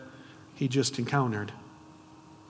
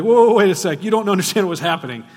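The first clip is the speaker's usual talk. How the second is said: defensive protest; 3 semitones higher, 8 dB louder, faster than usual. Retold or imitated. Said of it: "You'd be like".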